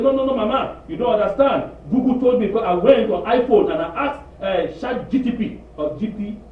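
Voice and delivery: high, loud excited insisting; mock excited daughter; insistent daughter. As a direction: defend the boyfriend eagerly. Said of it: "She said".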